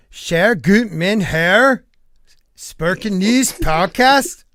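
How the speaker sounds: slow, drawn-out goofy announcer read of the names; silly drawn-out names. Pretend. Host mock-announcing chat usernames to his stream in a slow, goofy voice. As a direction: stretch each name out like a silly announcer.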